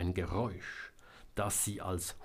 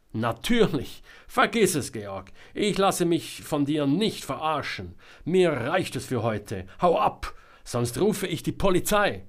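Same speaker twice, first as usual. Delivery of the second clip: loud, raised, fed-up retort; angry dismissal; 6 semitones higher, 6 dB louder than usual. A narrator's reading. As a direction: send someone packing with a loud threat. Selma angrily dismisses Georg. (laut und verärgert abweisen)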